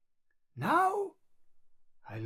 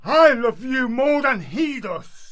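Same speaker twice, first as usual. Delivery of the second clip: shouted boast of love, heated; a loud, competitive cry of devotion; loud rival declaration; 3 semitones higher, 13 dB louder than usual. Acted. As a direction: outshout the rival suitor. Rival lover outbidding Lysander.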